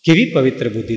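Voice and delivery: ordinary talk, nothing marked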